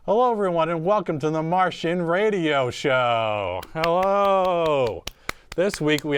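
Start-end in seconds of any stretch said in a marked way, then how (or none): none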